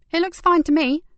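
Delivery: all seems fine to her; receptionist reassuring. Acted.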